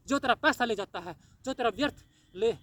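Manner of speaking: usual speaking voice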